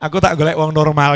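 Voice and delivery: loud mock rejection from the bride; woman snapping back. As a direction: play the fed-up bride telling him to get lost. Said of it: "Kata yang perempuan".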